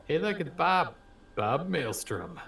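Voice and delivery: normal talking voice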